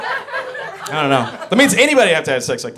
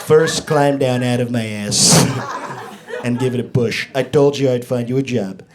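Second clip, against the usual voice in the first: Trump impression; 4 semitones lower than usual. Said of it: "our current president, he'd be like".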